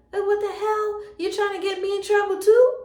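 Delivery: high, loud indignation; indignant reaction. Retold or imitated. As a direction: raise pitch and volume in exasperated disbelief. Indignant inner reaction to the girl.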